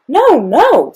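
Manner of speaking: high, loud exasperated protest; exasperated doctor objecting, pitched high; exasperated objection. A narrator's reading. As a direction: cut in loudly to stop a mistake. Exasperated doctor corrects Amelia's misunderstanding.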